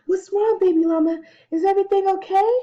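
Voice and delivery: high, loud, breathless alarm; out of breath. A narrator's reading. Mama bursts in on baby llama, out of breath. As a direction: call it out urgently as if just run upstairs. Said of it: "She's out of breath".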